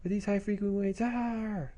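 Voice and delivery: high, acted wail of alarm; pained villain. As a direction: cry out like a villain overcome by noise. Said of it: "Even the bad guy's like".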